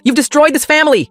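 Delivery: high, loud furious scream. A narrator's reading. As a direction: scream it at him, shrill with rage. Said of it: "my mom screamed".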